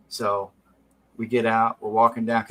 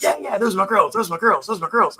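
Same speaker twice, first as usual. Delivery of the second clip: proud buddy; 7 semitones higher, 3 dB louder, faster than usual. Retold or imitated. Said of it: "And Cooney's like".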